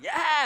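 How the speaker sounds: high, loud excited "yeah"; imitated giddy squeal. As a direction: mimic bouncy little girls with a squealed cheer. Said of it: "always skipping around".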